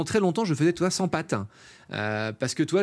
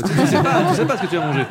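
loud exclamation; 5 semitones higher, 7 dB louder, faster than usual. Retold or imitated. Retold outburst at friend.

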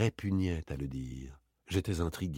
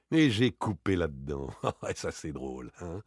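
lower, breaking into a laugh; amused; 3 semitones lower than usual. Acted. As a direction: chuckle at your own gullibility. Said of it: "eut un accès de franche gaieté". (amusé, en riant)

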